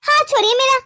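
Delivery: high, loud huffy rebuff; angry. Acted. Sulking wife rebuffs husband's touch, angry. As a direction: huff and push him away with your voice. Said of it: "इतना गुस्सा आने वाली तो कोई बात नहीं".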